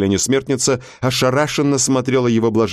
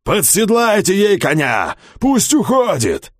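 shouted command; 10 semitones higher than usual. A narrator's reading. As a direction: bark it out for all to hear. Priest commands crowd loudly.

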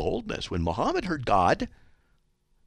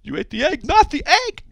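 very high, loud on the reversal; mocking parody; 8 dB louder than usual. Host parodies the emailer's broken logic, high mocking voice. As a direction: parody a self-contradiction in a squeaky-high shout.

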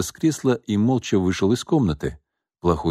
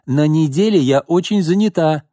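put-on high girl voice; 8 semitones higher, 5 dB louder than usual. Roxana businesslike.

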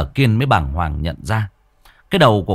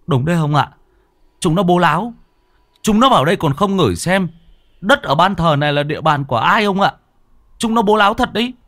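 put-on high, eager boyish voice; lightly voiced young man jeering at the ghosts; boy cheering grandpa on; 8 semitones higher, faster than usual. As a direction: egg grandpa on with cheeky scorn. Boy eggs on grandpa against ghosts.

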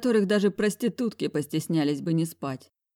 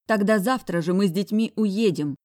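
usual speaking voice

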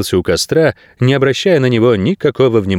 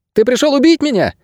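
put-on very high-pitched child's question; agitated boy. A narrator's reading. Boy asking if Найрод came to kill him, agitated. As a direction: ask it in a high boy's voice.

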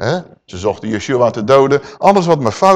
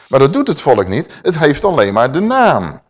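ordinary talk

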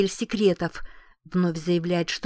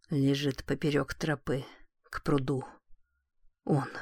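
faint deathbed whisper; 4 semitones lower, 6 dB quieter than usual. Acted. Dying husband speaks weakly.

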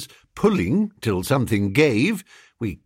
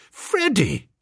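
high, imploring call; pleading cry; 7 semitones higher than usual. A narrator's reading. Narrator appealing to his pal, pleading cry. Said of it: "My voice shook".

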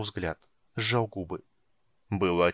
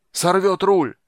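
high, loud panicked yell; bellowing; 9 semitones higher, 9 dB louder, slower than usual. A narrator's reading. Captain sees monster tearing at rudder, bellowing. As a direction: bellow it across the deck. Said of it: "орал Подуарак".

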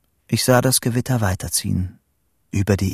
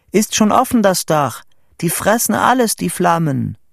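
high, raised breathless-news delivery; excited calling; 10 semitones higher, 4 dB louder than usual. A narrator's reading. Boy telling Vito the school is burning, excited calling. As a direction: commentate a blaze from a lookout, thrilled. Describes imagined fire to friend, calling.

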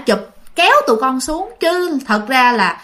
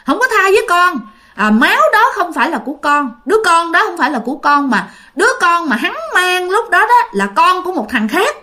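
sharp, high indignant retort; angry denial; 6 semitones higher than usual. Retold or imitated. Dead son angrily denying the child is his, high and heated. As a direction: snap back in hurt anger, pitched up. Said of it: "Thì anh B mới tức lên".

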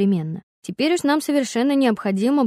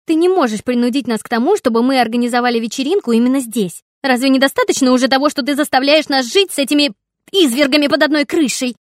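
put-on loud, higher, outraged girl; indignant shouting; 5 semitones higher, 7 dB louder than usual. A narrator's reading. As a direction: hurl it at him. Daughter shouts at father. (возмущённый крик)

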